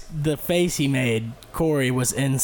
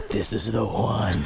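mock menacing Tyler; 5 semitones lower, slower than usual. Tyler choosing his stick.

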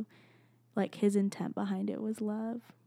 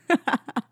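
loud, high burst of laughter; real laugh; 6 semitones higher, 9 dB louder than usual. Real, not acted. Podcaster laughs at her own sound effects, loud real laughter. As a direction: crack up at your own silliness.

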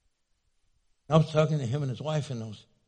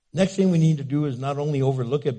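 ordinary talk, nothing marked

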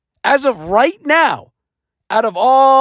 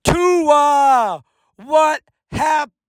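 anguished shout; 5 semitones higher, much slower than usual. Real, not acted. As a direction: yell the name in despair. Yelling at the Dolphins' collapse.

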